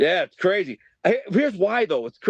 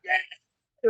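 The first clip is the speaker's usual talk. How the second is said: crowd cheer; 4 dB quieter than usual. Retold or imitated. Friends cheering in bleachers.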